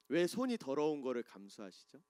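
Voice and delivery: normal talking voice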